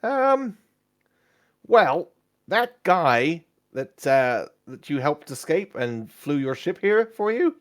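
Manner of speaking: awkward, hesitant companion; excitable adventurer Kezik. Acted. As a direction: break bad news reluctantly, drawing it out. Game master plays Kezik.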